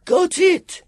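put-on high, loud triumphant child's shout; bawling. A narrator's reading. Sam finding the casket, bawling. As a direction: bawl it out like an excited boy. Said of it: "Sam bawled".

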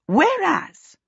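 high, emphatic interruption; interrupting. A narrator's reading. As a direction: break in firmly over someone. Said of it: "the witch interrupted".

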